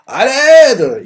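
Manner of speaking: shout for help. Acted. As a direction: scream for rescue. Both shout together for help.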